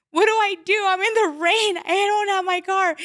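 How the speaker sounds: very high, loud, helpless wail; retold freak-out. Stranded caller in the rain to dispatcher, retold freak-out. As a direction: plead loudly in acted-out panic. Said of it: "And I'm, like, freaked out".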